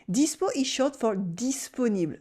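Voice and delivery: ordinary talk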